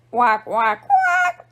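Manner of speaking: last quack jumps way up; duck snoring quacks. A narrator's reading. Duck snores while the pigs dance, squeaky quacks. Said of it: "snored Duck".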